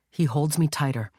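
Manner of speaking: plain reading voice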